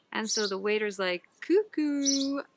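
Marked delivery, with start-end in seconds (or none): 1.4-2.4 mocking. Waiter mocks the man.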